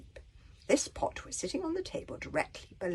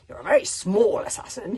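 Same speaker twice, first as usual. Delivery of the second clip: huffy; 3 semitones higher, 8 dB louder than usual. A narrator's reading. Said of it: "he said huffily".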